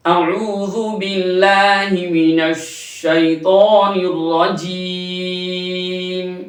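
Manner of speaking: slow melodic tajweed chant; slow sung Quran recitation. Shown as a demonstration. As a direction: draw each word out, recite.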